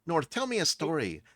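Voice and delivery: put-on high child voice, falling command; high-pitched child's order, ending firmly down. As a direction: tell him directly, as an insistent little kid. Child turns request into command.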